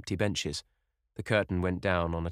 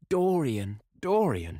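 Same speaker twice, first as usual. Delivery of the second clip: higher, louder calling of his name; ecstatic cry; 8 semitones higher, 5 dB louder, slower than usual. Acted. Sibyl to Dorian, ecstatic cry. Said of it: "she cried".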